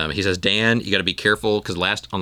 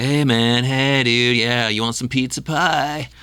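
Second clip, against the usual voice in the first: loud, higher, drawling stoner voice; stoner dude; 5 semitones higher, 3 dB louder, slower than usual. Acted. Pizza-place stoner offering pie, drawling stoner dude. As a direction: play a stoner pizza guy, stretching the last words. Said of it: "like, a stoner dude that works in a pizza place".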